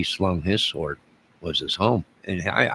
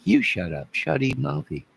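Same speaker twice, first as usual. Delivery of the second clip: retold retort, pitched much higher; mock snap back; 7 semitones higher than usual. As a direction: fire it back like a schoolyard comeback. Retold snapping back at Debbie.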